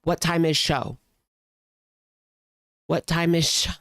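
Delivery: light imitation of a condescending lady; a hint of her talking down; mocking her condescending tone. Vlogger mimicking the lady's question, lightly mocking. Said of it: "She is so condescending".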